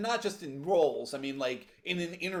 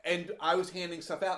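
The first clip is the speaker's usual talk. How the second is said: usual speaking voice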